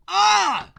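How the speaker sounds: shrill scream. Acted. Bracing for a loud character.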